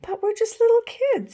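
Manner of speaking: put-on small child. A narrator's reading.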